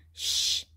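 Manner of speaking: sharp "shh" for silence; hushing. A narrator's reading. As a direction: hush someone urgently. Hushing the others to listen.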